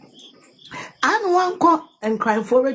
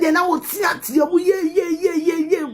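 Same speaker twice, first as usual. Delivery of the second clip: mocking shout; 8 semitones higher than usual.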